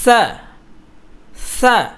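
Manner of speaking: সা said light and thin, tongue at teeth. Shown as a demonstration. Teacher showing students a thin সা sound. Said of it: "পাতলা হবে".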